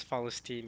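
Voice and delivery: Palestine said with F for P; Arabic pronunciation. Shown as a demonstration. Streamer sticking to Arabic, Palestine said "Falesteen". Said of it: "sure it's Palestine in".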